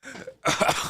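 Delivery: real coughing fit, choking on a chip; harsh dry cough. Real, not acted. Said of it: "I'm choking on an Arriba".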